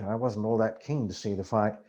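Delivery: plain reading voice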